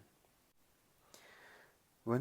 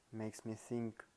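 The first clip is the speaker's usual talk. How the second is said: usual speaking voice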